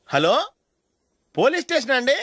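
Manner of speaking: high, pressing report to the police; urgent phone complaint. Acted. Caller reports the drunk driver to police, high and urgent. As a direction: sound urgent and wronged on the phone.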